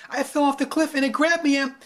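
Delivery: put-on eager pleading child; pleading girl. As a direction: rush to convince him. Girl pleads her story.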